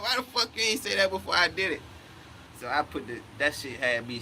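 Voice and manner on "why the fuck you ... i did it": mock outrage. Repeats outburst laughing.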